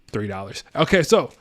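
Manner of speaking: normal talking voice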